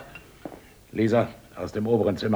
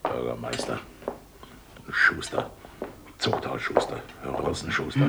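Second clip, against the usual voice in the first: 1 scornful mutter; 11 semitones lower, 3 dB quieter than usual. Acted.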